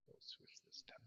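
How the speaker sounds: whispered, barely audible aside; whispering to oneself. Real, not acted. Player switching the roll to medicine, whispering to herself. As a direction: murmur it under your breath. Talking to self while setting dice.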